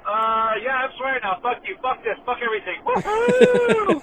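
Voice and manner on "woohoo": loud, high whoop; cheer. Acted. Caller whooping in his own voicemail, loud and high. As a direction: let out a big woohoo.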